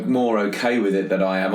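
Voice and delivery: ordinary talk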